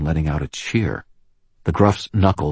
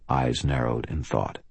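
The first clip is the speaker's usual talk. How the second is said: ordinary delivery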